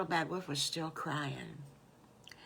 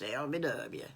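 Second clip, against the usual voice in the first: Southern accent; 6 semitones lower than usual. Shown as a demonstration. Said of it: "that's really Southern, folks".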